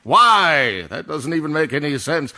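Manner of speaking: loud, exasperated outburst; affronted challenge; indignant disbelief. Acted. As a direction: throw it back loudly at the director. Balks at director's note.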